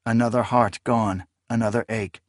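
plain reading voice

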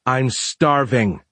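slow, strained after swallowing; choked up. A narrator's reading. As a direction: force it out past food. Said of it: "eyes watering, swallowed hard".

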